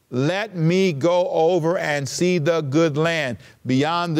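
slow, deliberate scripture reading. Shown as a demonstration. Preacher reads Moses' plea to God, slowly. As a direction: read it slowly, word by word. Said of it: "I'm gonna read it slow".

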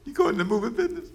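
high-pitched, disbelieving and fast; incredulous. Retold or imitated. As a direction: squeak out your disbelief at a truck. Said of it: "I'm thinking".

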